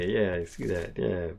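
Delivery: ordinary talk, nothing marked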